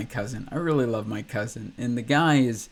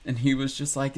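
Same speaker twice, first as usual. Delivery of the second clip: normal talking voice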